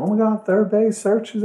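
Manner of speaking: star-struck fans spotting him; a high, excited fan voice. Retold or imitated.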